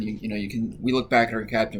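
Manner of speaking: ordinary delivery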